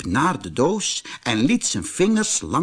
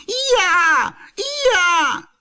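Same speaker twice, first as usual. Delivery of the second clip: high, loud donkey bray; 5 dB louder than usual. A narrator's reading. Donkey braying at the box, loud high bray. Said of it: "balkte heel hard".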